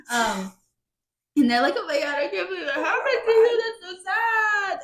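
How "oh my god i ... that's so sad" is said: high, whiny fake sobbing; hammed-up weepy wail; mock crying. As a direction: imitate tearful sympathy, pitched way up. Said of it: "She's, like, crying".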